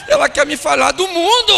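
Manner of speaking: high, rising mock despair; exasperated husband's thought. Husband overwhelmed by wife's news, hammed-up exasperation. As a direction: blurt it in overblown bewilderment. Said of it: "O cara pensa".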